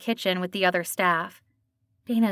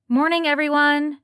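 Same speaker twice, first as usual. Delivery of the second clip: bright, higher and louder greeting; cheerful; 6 semitones higher, 5 dB louder than usual. A narrator's reading. Dana greeting her staff, cheerful. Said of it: "she said cheerfully".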